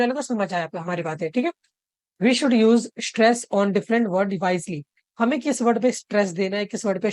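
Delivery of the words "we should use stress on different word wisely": slow dictation. Shown as a demonstration. Reads the key sentence slowly.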